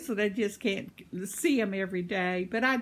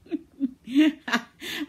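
loud, high burst of laughter; real laugh; 7 semitones higher, 8 dB louder than usual. Real, not acted. Laughing at her own joke to viewers, a loud real laugh.

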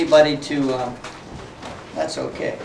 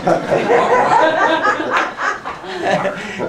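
laugh; 10 semitones higher than usual. Real, not acted. Laughs at his own joke.

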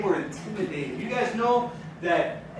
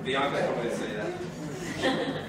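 chuckling, much softer and lower; laughing; 7 semitones lower, 8 dB quieter, slower than usual. Real, not acted. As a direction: laugh it off under your breath. Jokes about a dirty joke.

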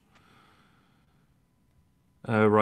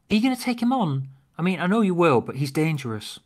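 worried companion voice; 7 semitones higher, faster than usual. Acted. Acted script dialogue, worried companion.

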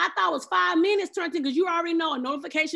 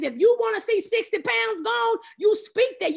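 loud, higher push to the congregation; preaching exhortation; 6 semitones higher, 3 dB louder than usual. Real, not acted. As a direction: drive it home to the crowd.

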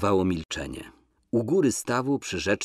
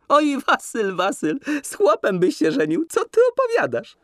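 much higher, louder laughing reply; bursting out laughing; 5 dB louder than usual. A narrator's reading. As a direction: laugh it out, amused. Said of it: "wybuchnęła długim, głośnym śmiechem".

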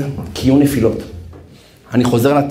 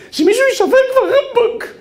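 pleading cry. Retold or imitated. Soldier begging someone quit.

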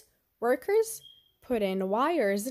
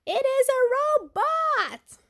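much higher and louder, slow thrilled cry; excited shout; 6 dB louder than usual. Acted. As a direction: shout it out in amazement. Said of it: "I shout".

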